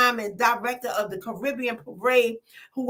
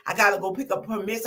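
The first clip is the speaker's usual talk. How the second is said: usual speaking voice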